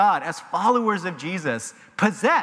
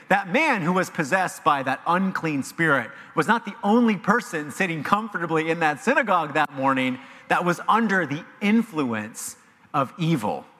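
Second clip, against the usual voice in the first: ordinary talk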